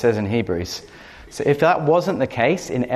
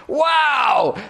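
amazed cry; 14 semitones higher than usual. Retold or imitated. Friend Phil exclaiming as he feels God's power, amazed shout. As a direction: cry out as if power hits you.

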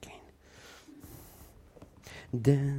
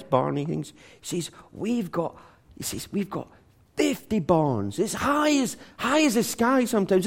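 bragging Texan; 9 semitones higher than usual. Retold or imitated. Texan farmer bragging, pitch high.

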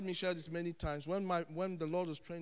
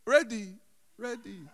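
worship leader's big shout dying away; acted rallying call that trails into emptiness; calling out, fading; 5 semitones higher, 17 dB louder than usual. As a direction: start very loud and high, then peter out. Acts worship leader calling sparse congregation.

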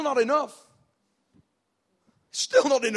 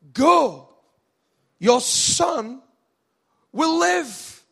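loud, raised, spaced-out pronouncement; commanding proclamation; 5 semitones higher, 5 dB louder, much slower than usual. Acted. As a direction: declare it in separate, ringing phrases. Jesus' pronouncement to the official.

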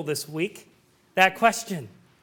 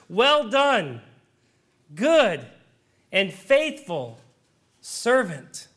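slow, drawn out, each word weighed; God's solemn blessing; 2 semitones lower, much slower than usual. Acted. Preacher voicing God's blessing to the believer, slow and solemn. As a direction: lean on good and faithful with long pauses between.

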